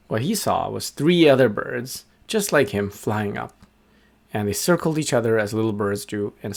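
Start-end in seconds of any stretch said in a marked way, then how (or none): none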